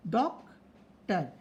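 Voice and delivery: "डॉक्टर" split as dok... tar with a gap; teacher separating the parts of "डॉक्टर"; syllable by syllable. Shown as a demonstration. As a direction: slowly break "डॉक्टर" into two syllables. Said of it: "जितने syllable है".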